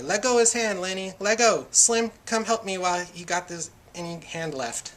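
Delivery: storyteller acting repeated urgent yells; shouting. A narrator's reading. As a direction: shout for release and for help. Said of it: "George shouted over and over".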